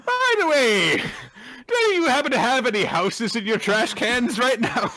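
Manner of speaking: high, giggling through the question; laughing. Real, not acted. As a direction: laugh while asking.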